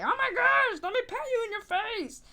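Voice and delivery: very high, loud mock squeal of excitement; mock gushing. Mimicking someone rushing up to pet a stray, mock squeal. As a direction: overdo the gush at a cute dog.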